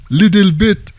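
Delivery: imitating a heavy French accent. Answering the French woman in a thick French accent. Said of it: "I said in English with a heavy French accent".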